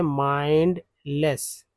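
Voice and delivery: माइंडलेस very slow, ending clearly in लेस; teacher models माइंडलेस slowly; slow pronunciation demo. Shown as a demonstration. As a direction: stretch माइंडलेस out for learners. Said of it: "एलईडी को लेस बोलते हैं".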